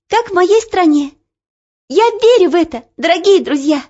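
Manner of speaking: high, loud, impassioned address; ringing girlish voice. A narrator's reading. Girl addressing the townspeople in farewell, high ringing voice. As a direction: proclaim it clearly to the whole town. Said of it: "её слабый голосок вдруг стал таким звонким".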